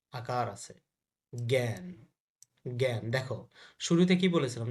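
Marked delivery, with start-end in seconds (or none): 1.3-3.0 জ্ঞান pronounced "gæn", clearly separated; জ্ঞান with a g sound. Shown as a demonstration. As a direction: say জ্ঞান as "gyan", opening on a hard g. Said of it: "গ হবে". (জ্ঞান-এর শুরুতে গ উচ্চারণ, "গ্যাঁন")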